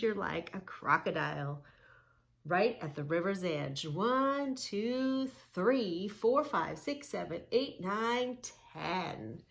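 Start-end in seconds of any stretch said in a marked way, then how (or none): 3.9-9.4 put-on lively up-and-down counting for kids; sing-song counting. Shown as a demonstration. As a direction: count along like a preschool teacher.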